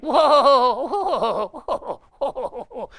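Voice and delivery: high, loud repeated whoas; mock freaked-out whoa, laughing; imitating startled bandmate. Robert freaking out in the dark, high loud mock whoas. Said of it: "Robert's like".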